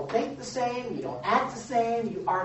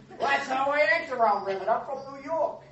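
mock New Yorker; 6 semitones higher than usual. Mocking an excuse for behaviour.

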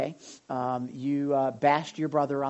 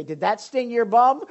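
higher and louder, teasing; playful father's question; 7 semitones higher, 10 dB louder than usual. Retold or imitated. Father asking his son after the spanking, playful. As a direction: ask it with a grin.

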